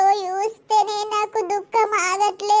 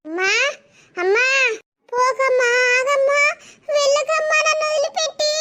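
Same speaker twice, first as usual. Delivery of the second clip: rising higher with each desperate call; tearful pleading; 5 semitones higher than usual. Acted. Child calling after her leaving mother, pleading tearfully. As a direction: beg her not to go, voice climbing.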